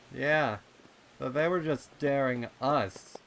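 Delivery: put-on high, loud kid voice; colt voice. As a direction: point it out like a young colt. Snails answering.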